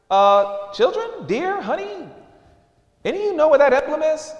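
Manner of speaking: high, fast, anxious parent asking around; worried host voice. Acted. Homeowner anxiously asking the children about the missing emblem, high-pitched.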